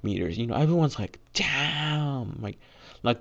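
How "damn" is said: a high, drawn-out, amazed "Damn!"; stunned crowd reacting to a record-breaking throw, amazed; amazed exclamation. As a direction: let the whole crowd gasp it out.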